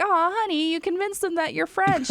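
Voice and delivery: high, syrupy mock-sweet coo; sugary in-character fiancée. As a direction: coo it at him like a doting fiancée. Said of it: "And I was like".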